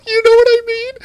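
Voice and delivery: high, loud laughing aside; cracking up mid-sentence. Real, not acted. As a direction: squeal it through a laugh. Laughs after own impression.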